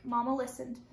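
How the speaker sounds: usual speaking voice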